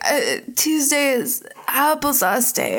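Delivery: mock delirium, drifting and drawn out; fake drugged. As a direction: babble as if about to pass out. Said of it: "loses consciousness".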